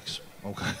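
breathy, nervous okay; nervous whisper. Retold or imitated. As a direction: whisper it uneasily. Nervous agreement.

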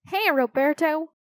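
high-pitched, mild yell; yelled greeting. A narrator's reading. Another kid to Roberto, mild yell. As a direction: call out hello from afar. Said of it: "another kid yelled".

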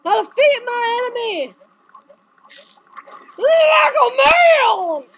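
high shout swelling into a long roar; over-the-top war cry; battle cry. Real, not acted. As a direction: bellow it heroically, dragging out the end.

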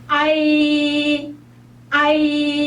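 아이 slowly, weight on 아; long stretched 아이 leaning on 아; stressed a in ai. Shown as a demonstration. Teacher showing 아이 slowly with stress on 아. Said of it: "이렇게 해보세요".

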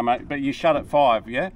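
ordinary talk

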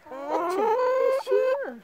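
high doting voice for the hen; cooing pet talk. Real, not acted. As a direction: coo it up high like praising a pet.

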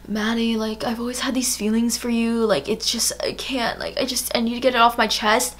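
soft, halting confession; hushed, faltering heart-to-heart; love confession. Retold or imitated. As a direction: imitate a drunk friend confessing his love. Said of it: "confessing his undying love for me".

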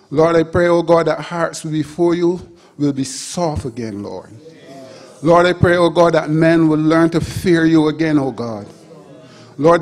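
fervent, loud prayer, binding and breaking; spiritual warfare prayer. Real, not acted. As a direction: pray with rising fire over the men. Fervent intercessory prayer, rising loud.

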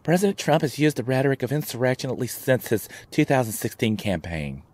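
lightly put-on mock liberal pundit voice; a hint of a smug liberal newsreader, mocking; liberal's voice. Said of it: "let me use a liberal's voice".

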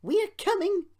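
very high, loud excited call; shrill, joyful shout; whistled call. A narrator's reading. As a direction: call ahead like a train whistle. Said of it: "he whistled to Duncan".

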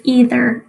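"either" with a long E, EE-ther; long-E pronunciation. Shown as a demonstration. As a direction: say "either" starting like "eat". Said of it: "two ways people, um, pronounce that word".

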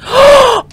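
high, loud yelp of fright; play-acted shriek at the sight; mock scream. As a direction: let out a pretend scared scream. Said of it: "I'd be like that".

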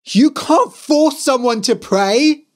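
high, loud indignant shout. Acted. Tobias shouting at his parents through the door. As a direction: shout it in outrage. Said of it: "he shouted".

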